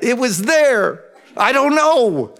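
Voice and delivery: high, drawn-out mock wail; protesting child. Child defending a breakage to a parent, high protest. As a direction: whine it like a cornered kid.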